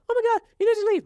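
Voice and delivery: high, mocking girly pitch; squeaky mock of the girlfriend.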